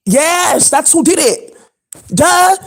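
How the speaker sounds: sassy duh. Real, not acted. Sassy answer to own question.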